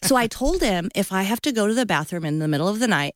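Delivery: ordinary talk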